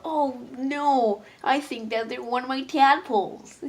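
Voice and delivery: a playful wail of fatherly alarm; mock dismay. Buff Frog fretting about his tadpoles, mock dismay.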